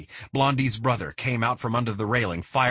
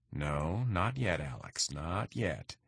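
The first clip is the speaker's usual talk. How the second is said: much softer, low, holding him back; urgent hushed warning; 3 semitones lower, 8 dB quieter, slower than usual. A narrator's reading. Partner quietly warns Alex not to draw, hushed. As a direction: keep it down so the gunmen can't hear. Tense warning during gun standoff.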